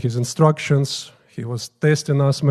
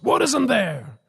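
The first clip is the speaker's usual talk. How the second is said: high, sudden demanding yell; retold yell; 9 semitones higher than usual. Guru suddenly squeezing a priest's chest, retold yell. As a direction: imitate a guru's yell in a higher voice. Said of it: "squeezes hardly and yells".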